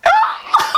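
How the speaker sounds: high shouted burst of laughter; squealing flustered laugh; shrieking laugh. Real, not acted. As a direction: shriek with delight. Flattered laugh, imagined compliment.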